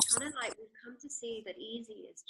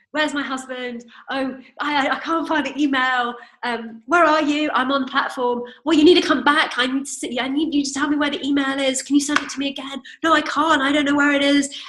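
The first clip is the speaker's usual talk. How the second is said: frantic and loud, words tumbling; loud flustered panic; panicked phone call; 4 semitones higher, 16 dB louder than usual. Retold or imitated. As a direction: relive the panic of a lost ticket on the phone. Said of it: "complete thought storm".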